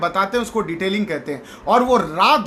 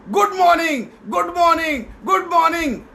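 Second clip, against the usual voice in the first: higher, shouted good mornings over and over; repeated shouts; 7 semitones higher than usual. Retold or imitated. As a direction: push the voice harder each time. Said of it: "अब वो बोलने लगा". (झिझकते लड़के की बार-बार ऊँची आवाज़ में गुड मॉर्निंग)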